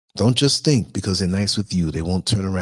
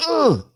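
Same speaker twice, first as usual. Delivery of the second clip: mock groan. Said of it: "The whole bus was like".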